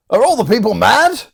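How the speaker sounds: high, loud disbelief; hammed-up incredulous question; theatrical exclamation. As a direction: cry it out in stage bafflement. Performs Sebastian's exclamation from play.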